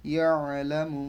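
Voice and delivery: ইয়া আলা মু with a throat-squeezed আ; throaty ain. Shown as a demonstration. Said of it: "আটাকে গলার মধ্যে নিতে হবে". (ইয়া আলা মু, আ গলার ভেতর থেকে)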